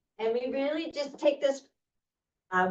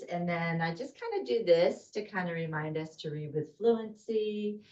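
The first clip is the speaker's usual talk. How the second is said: usual speaking voice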